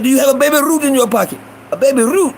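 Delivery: high, accusing storekeeper voice; Iranian shopkeeper. Retold or imitated. Shopkeeper presses boy about candy in pocket, imitated high and accusing. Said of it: "it was the Iranian motherfucker".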